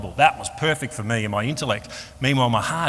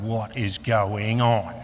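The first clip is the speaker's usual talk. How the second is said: very slow, word by word; drawn-out baffled self-questioning; acted bewilderment; much slower than usual. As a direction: stretch each word out in disbelief. Acted bewilderment at his own crying.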